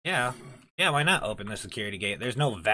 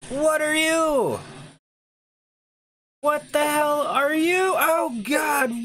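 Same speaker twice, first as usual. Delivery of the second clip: high, loud panicked screaming; terrified horror victim; 13 semitones higher, 5 dB louder than usual. Real, not acted. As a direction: scream and flee in terror. Monster chase in horror game.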